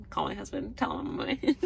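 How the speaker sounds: ordinary delivery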